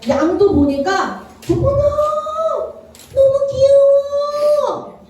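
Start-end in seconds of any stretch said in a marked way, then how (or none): 1.5-5.0 high, slow, cooing delight at a cute lamb; gushing over cuteness. Acted. King gushing over a lamb he should destroy, high slow cooing. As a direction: melt over the little lamb.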